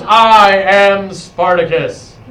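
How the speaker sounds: slow, loud dramatic declaration; I am Spartacus. Retold or imitated. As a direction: stand up and proclaim it, slowly. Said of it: "He goes".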